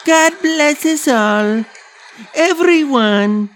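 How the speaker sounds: put-on squeaky child actor fumbling his line; mock Tiny Tim. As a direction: ham up a nervous kid in a Christmas play forgetting his line. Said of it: "the kid doing the fucking Christmas play playing Tiny Tim".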